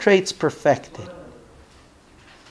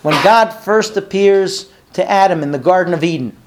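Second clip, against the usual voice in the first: normal talking voice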